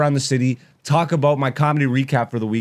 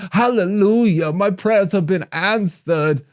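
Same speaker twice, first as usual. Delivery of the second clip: high, drawn-out hallelujah testimony; mock grateful commenter; 6 semitones higher than usual. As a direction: exaggerate the rapture of a converted follower. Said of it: "people like".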